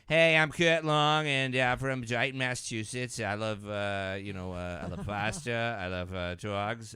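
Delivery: hammed-up Boston accent, drawn-out words; old Boston accent. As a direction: drag it out in a mock Boston accent. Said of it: "I didn't think I sounded like that".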